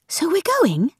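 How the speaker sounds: light up at the news; excited. A narrator's reading. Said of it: "Rachel asked excitedly".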